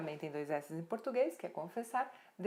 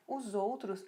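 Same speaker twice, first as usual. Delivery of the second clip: ordinary delivery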